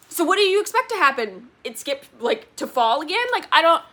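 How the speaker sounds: high, loud, incredulous questioning; exasperated disbelief. Acted. Reader asking the author what they expected, incredulous. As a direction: challenge the author, losing patience.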